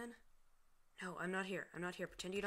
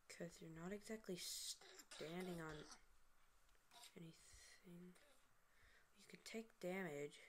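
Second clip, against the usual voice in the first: whisper; 10 dB quieter than usual. Real, not acted. Aside about game.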